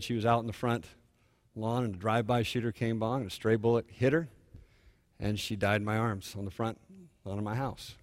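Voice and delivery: low, hushed account of a loss; retold grief. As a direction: quietly voice a bereaved father, with pauses. Retelling a father's loss.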